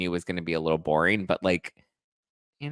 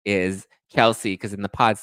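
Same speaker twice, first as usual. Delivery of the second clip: ordinary talk, nothing marked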